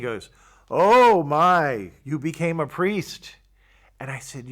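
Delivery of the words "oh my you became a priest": loud, high-pitched astonished outburst, retold; amazed exclamation. Old confessor recognizing his former penitent, imitated loud amazement. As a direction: draw it out in booming, delighted amazement.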